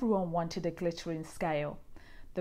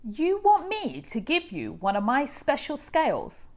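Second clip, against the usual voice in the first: higher, louder, fast offended outburst; indignant cry; 8 semitones higher, 5 dB louder than usual. A narrator's reading. Rainbow fish snaps at the little blue fish, proud and indignant. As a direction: scoff in proud disbelief. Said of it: "cried the rainbow fish".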